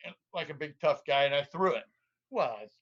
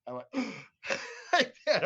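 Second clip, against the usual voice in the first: mock gasp; 8 semitones higher than usual. Retold gasp of excitement.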